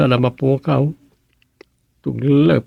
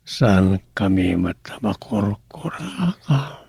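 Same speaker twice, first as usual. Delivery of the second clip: breathy hushed whisper, slow; 3 semitones lower, 4 dB quieter, much slower than usual. Acted. Mother whispering her worry about their child. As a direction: let the question slip out in a whisper.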